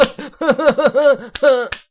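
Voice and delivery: high, loud cheering whoop, imitated; mock fan cheer. Fans cheering the commentary booth, high loud whoop. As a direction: mimic a fan clapping and whooping. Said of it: "just there clapping and going".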